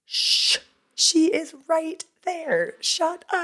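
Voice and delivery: high, slow mock panic hush; urgent hush. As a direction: shush her before she's overheard. Friend hushing her on plane.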